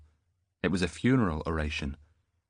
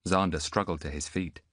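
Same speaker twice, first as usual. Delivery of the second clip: ordinary delivery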